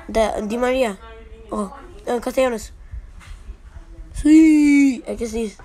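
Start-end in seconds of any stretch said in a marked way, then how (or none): 4.1-5.0 excited. Real, not acted. Good pull reaction.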